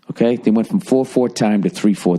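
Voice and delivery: normal talking voice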